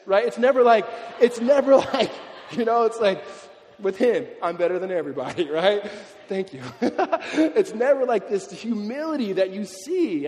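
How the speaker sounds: loud, high talk broken by laughter; laughing excitedly. Real, not acted. Preacher delighted by the football player's humility, laughing loudly. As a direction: laugh through it, thrilled.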